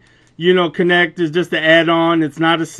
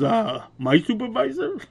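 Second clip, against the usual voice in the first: higher, softer, breaking into a laugh; call-centre agent laughing at the request for a supervisor; laughing disbelief; 5 semitones higher, 7 dB quieter than usual. Retold or imitated. A surprised, laughing question.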